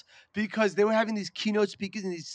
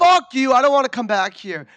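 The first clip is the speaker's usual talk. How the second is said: mock offended shout; 3 semitones higher, 8 dB louder than usual. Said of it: "They'd be like".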